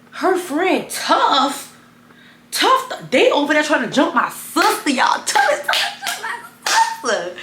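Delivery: high, loud, urgent outburst; excited. Real, not acted. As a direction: shout the news to viewers. Calling out the fight excitedly.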